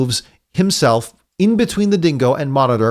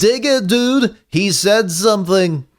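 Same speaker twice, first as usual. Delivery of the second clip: much higher mock Liverpool accent; 7 semitones higher than usual. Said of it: "I'm just doing [name]".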